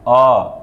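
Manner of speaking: आ with the mouth wide open, drawn long; open mouth vowel. Shown as a demonstration. Teacher showing the wide-open आ to students. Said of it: "पूरा मुंह खोल के पढ़ेंगे अ".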